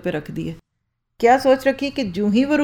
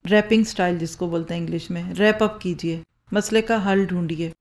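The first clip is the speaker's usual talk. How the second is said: ordinary talk, nothing marked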